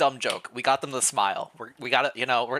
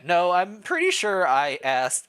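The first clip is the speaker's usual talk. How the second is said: insistent retry; 6 semitones higher, 5 dB louder, slower than usual. Acted. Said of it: "You try again".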